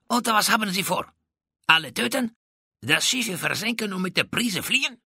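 high-pitched, indignant questioning; hissed indignation. A narrator's reading. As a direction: throw accusing questions at your captor. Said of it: "zischte San Juan de Anton".

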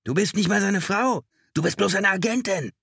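high, loud accusing yell; hysterical, high-pitched outburst; furious scream. A narrator's reading. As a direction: scream abuse at someone beside you. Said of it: "schrie Chalker".

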